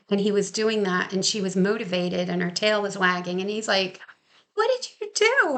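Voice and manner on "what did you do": amazed dad. Retold or imitated. Dad asking the trainer about his happy dog, high amazed voice. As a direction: squeal the question in disbelief.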